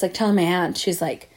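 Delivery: ordinary talk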